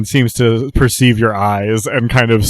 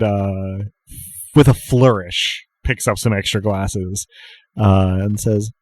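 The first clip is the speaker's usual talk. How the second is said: ordinary talk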